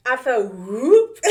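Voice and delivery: loud, high, drawn-out whoop of surprise; sudden whoop. Retold or imitated. Telling viewers how her lips ballooned, loud surprised whoop. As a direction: let the whoop burst out slow and loud.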